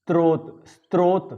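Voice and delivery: स्रोत with an inserted t: "strot"; स्रोत with "str" at the start; common mispronunciation. Shown as a demonstration. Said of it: "ये बोल देते हैं".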